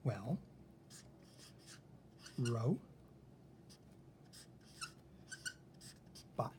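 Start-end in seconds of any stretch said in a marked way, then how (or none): 2.4-6.5 "ro-" and "bot" as separate syllables, long pause between. Shown as a demonstration. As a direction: sound out "ro-" with a long O, wait, then "bot". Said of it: "break up the syllables".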